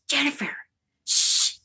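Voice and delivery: hushed, soft shushing of a daughter; mother's hush. Retold or imitated. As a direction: quietly shush her down. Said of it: "my mother turns around and she goes".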